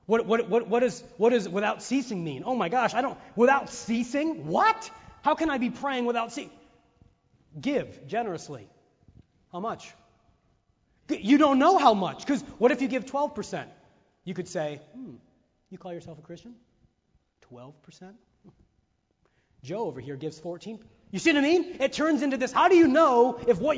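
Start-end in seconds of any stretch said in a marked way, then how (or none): 0.1-6.5 higher, louder flustered disbelief; mock panic. Preacher playing a worried churchgoer, mock-flustered and loud. Voicing an anxious believer. 14.9-20.8 hushed, softer sneer; lightly voiced judgmental church member; snide judging. Preacher mimicking a judgmental believer, softer and snide.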